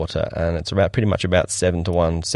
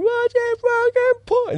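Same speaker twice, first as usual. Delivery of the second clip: far higher, slow yelled question; hammed-up shouting from the boat; mock crew shout; slower than usual. Crew on boat yelling at swimmer, mock high shout. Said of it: "are all shouting at me".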